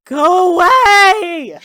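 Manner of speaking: a half-asleep brother yelling from under a pillow; groggy shout. Acted. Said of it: "and he says, like".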